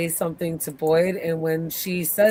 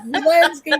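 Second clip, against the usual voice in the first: laughing quote; 10 semitones higher, 6 dB louder than usual. Retold or imitated. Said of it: "He's like".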